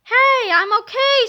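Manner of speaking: high, loud bright surprise; surprised relief. Acted. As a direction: pop up amazed you're unhurt. Principal sits up after fall.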